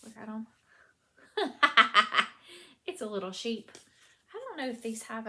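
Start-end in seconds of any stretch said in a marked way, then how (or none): 1.4-2.6 laugh. Real, not acted. Looking at sheep sock blockers.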